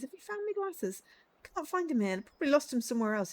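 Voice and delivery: put-on high, fumbling voice of a lost young man; squeaky, befuddled young man hunting his glasses; high confused voice searching for his glasses; muddled young man. Voicing lost short-sighted young man.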